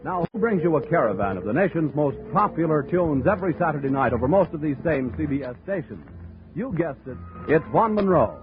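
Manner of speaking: ordinary talk, nothing marked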